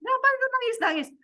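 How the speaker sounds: high, scoffing mother's voice; mother mocks her child for crying, high scornful voice; mocking mother. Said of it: "terus mama aku bilang gini".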